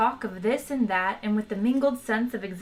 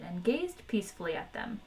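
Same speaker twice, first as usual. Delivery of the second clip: plain reading voice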